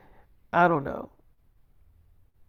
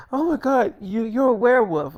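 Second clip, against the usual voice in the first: higher, louder shocked exclamation as a fan; mock startled gasp; 7 semitones higher, 5 dB louder than usual. Girl startled to recognize the werewolf star, shocked and higher. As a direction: squeal it like a starstruck girl.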